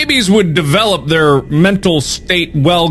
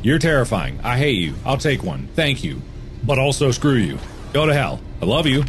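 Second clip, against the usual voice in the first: normal talking voice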